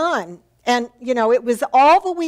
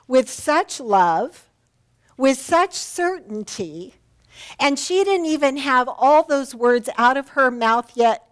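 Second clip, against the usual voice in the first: ordinary talk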